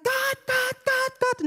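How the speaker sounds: high, nagging plea to God; whiny pleading. Retold or imitated. Believer nagging God to hurry up, high whiny pleading. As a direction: whine Gott over and over like a child. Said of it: "stehen daneben und sagen".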